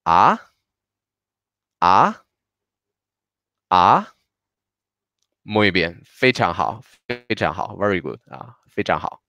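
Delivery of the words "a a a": "A" starting mid and climbing to the top; rising second tone. Shown as a demonstration. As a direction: lift each "A" steeply, like a curious question. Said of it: "A y tono segundo".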